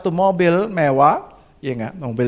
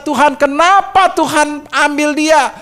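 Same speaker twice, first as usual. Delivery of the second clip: higher, louder grieving plea to God; lamenting husband pleading with God; anguished prayer; 10 semitones higher, 5 dB louder than usual. Retold or imitated. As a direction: cry out to God in retold grief. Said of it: "Saya begitu sedih".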